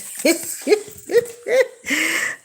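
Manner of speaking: long high giggle; a run of high-pitched chuckles; laughing. Real, not acted. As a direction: giggle helplessly, high and drawn out. Chuckles after her remark.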